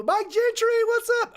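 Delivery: bright shouted hello; excited greeting. Real, not acted. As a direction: greet him like a long-lost friend.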